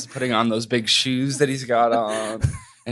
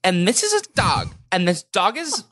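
high bubbly talk-show host voice, slower at the end; Drew Barrymore impression; 7 semitones higher, slower than usual. Husband imitates a talk-show host, high gushing voice. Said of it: "Does that sound like her?".

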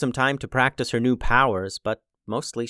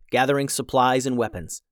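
ordinary delivery, nothing marked

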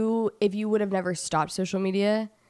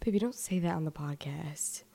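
soft slow whisper; 4 semitones lower, 9 dB quieter than usual. Real, not acted. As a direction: hush it under your breath. Hushed aside to partner on podcast.

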